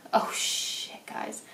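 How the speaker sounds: whispered oh shit, fading almost to nothing; hushed curse. Real, not acted. Reader startled by a card, cursing under her breath to viewers. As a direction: let it slip under your breath.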